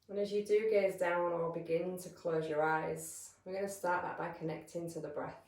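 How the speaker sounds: calm, measured meditation voice with long pauses; guided meditation. Real, not acted. Yoga teacher settling listeners into breath awareness, calm guided meditation. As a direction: guide them gently into stillness, leaving space between phrases.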